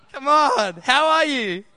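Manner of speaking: high, loud call to the crowd; rousing shout for a response; rallying call. Real, not acted. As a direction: fire up the room to answer. Rousing congregation to answer back.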